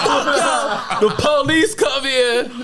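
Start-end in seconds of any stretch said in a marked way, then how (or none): 0.0-1.0 high laughing retort; laughing mock curse. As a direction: spit it out through giggles. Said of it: "He's like".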